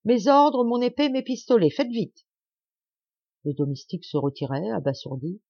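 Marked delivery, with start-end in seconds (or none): 0.0-2.3 raised, urgent orders; commanding. A narrator's reading. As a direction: fire off orders to the valet. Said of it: "commanda monsieur de Sairmeuse".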